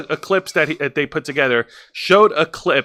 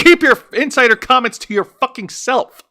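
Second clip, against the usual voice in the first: high-pitched, fed-up rant; exasperated scolding; 9 semitones higher than usual. Real, not acted. Podcast host scolds a careless commentator, exasperated and high. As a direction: tell them off, voice riding up.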